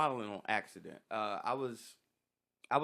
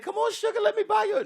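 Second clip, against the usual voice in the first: much higher, laughing imitation of a flirt; mock flirty admirer; 9 dB louder than usual. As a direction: coo it like a flirty admirer, giggling.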